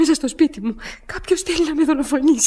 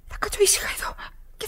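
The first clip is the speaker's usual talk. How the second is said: breathless whisper so nobody hears; frightened whisper; 4 semitones higher, 12 dB quieter than usual. Acted. Woman hiding from the intruder, whispering in fear. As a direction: hush it, terrified of being heard.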